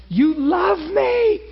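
high, slow, crying out; tearful cry. Real, not acted. Preacher alone with God, crying out in tears. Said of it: "I was so freaked out".

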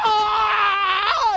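long, high, loud yell while charging off; acted wild howl, held long; reenacted scream. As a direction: scream as if running on a torn knee. Acting out running on torn knee.

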